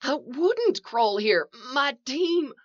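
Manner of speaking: high, drawn-out protest; distressed denial. A narrator's reading. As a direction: insist desperately, pitch climbing. Trapped man protests.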